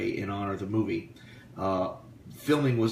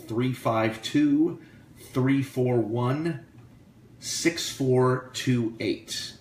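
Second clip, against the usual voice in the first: slow dictation; 3 semitones higher, much slower than usual. Real, not acted. Dictating phone number to listeners.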